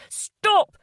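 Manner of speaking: high loud stop; desperate shout. Acted. Jay shouting at Felicity to stop. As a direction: cry it out. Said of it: "he said".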